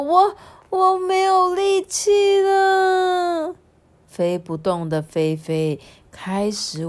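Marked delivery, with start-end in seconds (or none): 0.0-3.5 high, drawn-out hammed-up cry; exhausted wail. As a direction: wail that your strength is gone. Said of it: "这时候菲菲就说". (夸张地拖长哭腔)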